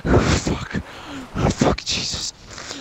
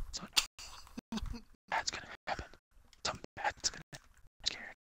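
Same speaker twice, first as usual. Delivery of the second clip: terrified whispering under the breath, very soft; scared whisper; 7 semitones lower, 18 dB quieter, faster than usual. Real, not acted. Scared of the ride, whispering to himself in real fear. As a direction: hush it out like something awful is coming.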